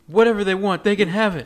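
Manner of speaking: loud, high-pitched re-enactment; dramatic plea. Retold or imitated. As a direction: act out the wife crying out to give anything. Co-host re-enacts wife's plea.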